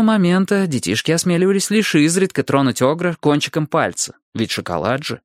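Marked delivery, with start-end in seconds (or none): none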